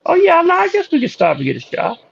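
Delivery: very high, squeaky impersonation; high-pitched, utterly sincere imitation; Beetlejuice. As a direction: imitate earnest denial turning to agreement. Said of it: "There's no irony whatsoever".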